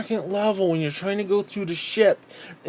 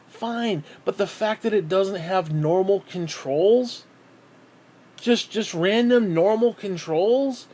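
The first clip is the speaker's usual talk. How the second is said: normal talking voice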